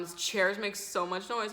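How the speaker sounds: ordinary talk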